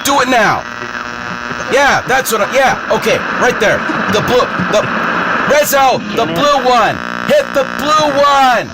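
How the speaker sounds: high pushy directions; high urgent calls. Real, not acted. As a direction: call out orders, high-pitched. Directing someone to bring him in.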